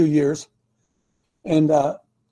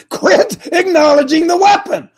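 high, loud, insistent command; emphatic exhortation; 9 semitones higher, 5 dB louder than usual. Real, not acted. Preacher urging listeners to quit repeating bad thoughts, emphatic exhortation. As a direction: drive the order home with raised pitch and force.

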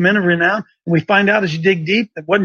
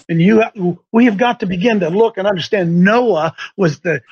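ordinary delivery

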